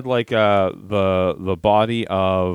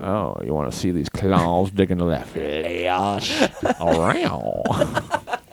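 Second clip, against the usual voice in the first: low, slow monster growl; playful monster voice; 6 semitones lower, much slower than usual. Pretend. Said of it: "I'm a monster".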